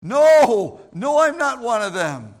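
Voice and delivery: a sudden loud no, then a firm denial; Peter denying again. Acted. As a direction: shout the no, then hold firm. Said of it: "he was a Galilean".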